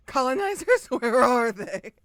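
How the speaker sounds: loud high mock demand with laughter; mock shout. Streamer jokingly yelling for the colonizers. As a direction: yell it as a joke, laughing.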